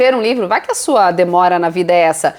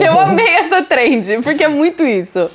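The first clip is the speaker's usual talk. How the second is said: laughing delight; 6 semitones higher than usual. Real, not acted. Laughing about the trend.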